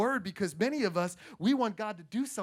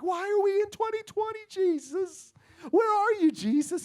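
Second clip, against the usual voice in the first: high, pleading mock whine to Jesus; mock desperate plea, pitched up; whiny church; 9 semitones higher, 3 dB louder than usual. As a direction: play the church crying out, where are you Jesus. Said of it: "we're like".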